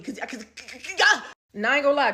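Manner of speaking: normal talking voice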